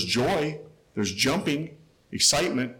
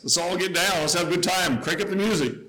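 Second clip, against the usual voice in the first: higher, mock party hype; mock party excitement; 6 semitones higher, 3 dB louder than usual. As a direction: play the eager partygoer, lightly.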